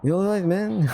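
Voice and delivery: lightly voiced friendly dingo checking in; Gil's voice. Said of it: "that's the voice".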